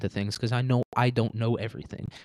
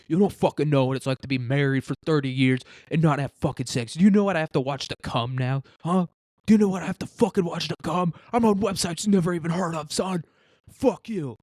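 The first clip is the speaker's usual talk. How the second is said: put-on higher, ranting older man voice; mock bitter married man; 5 semitones higher than usual. Hostage rants back at the young guard, higher. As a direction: rant back defensively, pitched up.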